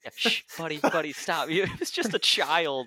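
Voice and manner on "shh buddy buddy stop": higher, louder mock hushing; mock shushing. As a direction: shush a yelling pal, half laughing. Voicing someone calming yelling Thor.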